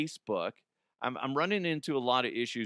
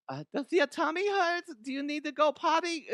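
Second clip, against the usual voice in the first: much higher, fussing Mama voice. Retold or imitated. Dad fusses at his kids before school in a high Mama voice. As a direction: coo at the kids like a fussing mom. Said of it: "Mama voice is fun".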